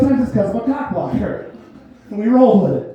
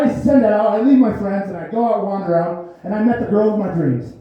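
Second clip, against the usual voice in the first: usual speaking voice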